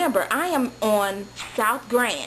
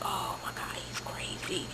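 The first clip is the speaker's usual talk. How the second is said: much softer whispered panic; scared whisper; 9 semitones lower, 13 dB quieter than usual. Retold or imitated. Girl hearing the message, whispering in fear. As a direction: breathe it out in alarm. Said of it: "I'm like".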